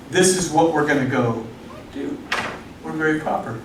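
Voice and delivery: low, soft, self-righteous; prim and proper. Retold or imitated. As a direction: say it piously, voice dropping.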